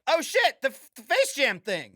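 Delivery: high, loud excited discovery; surprised. Retold or imitated. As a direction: blurt it out, delighted. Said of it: "I was like".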